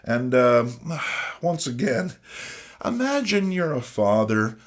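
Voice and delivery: normal talking voice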